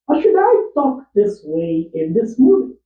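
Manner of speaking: high voice. Shown as a demonstration. Actor auditioning a high new voice for his film. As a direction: push the voice up high and ask. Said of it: "Is this how I want to sound".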